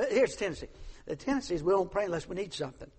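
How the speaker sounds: usual speaking voice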